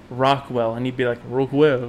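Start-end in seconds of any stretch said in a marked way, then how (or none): 1.3-1.9 put-on French accent. Friend imitating the French missionary repeating a word, French accent. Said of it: "French accent".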